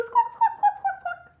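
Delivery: loud, very high bird squawks; piercing pretend parrot noise. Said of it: "lots of animal sounds in this song".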